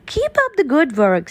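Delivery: quick encouragement. Acted.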